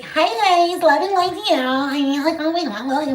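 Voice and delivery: high, sing-song gushing greeting; mocking spiritual girl. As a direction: coo it high like a love-and-light influencer. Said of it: "where the girl's like".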